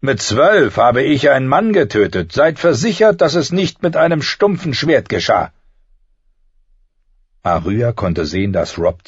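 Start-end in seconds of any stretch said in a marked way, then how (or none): 0.0-5.5 raised, louder, boastful challenge; menacing burned knight. A narrator's reading. Scarred knight scorning blunt swords, loud boast. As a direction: boast of it hard, voice lifted.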